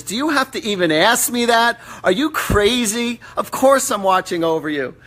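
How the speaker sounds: loud, high-pitched mock scolding; exasperated yelling. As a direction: yell it like an indignant mother, incredulous. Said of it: "she's yelling at you".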